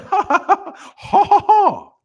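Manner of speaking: high-pitched fake "ha ha" laugh; mock laugh. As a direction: force out a hammed-up, high laugh. Mocking British reaction to dry joke.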